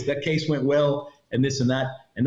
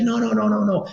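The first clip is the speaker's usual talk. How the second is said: panicked refusal; 9 semitones higher than usual. Retold or imitated. Woman refusing the smuggler, high mock panic. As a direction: refuse outright in fright. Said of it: "She's like".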